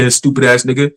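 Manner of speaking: usual speaking voice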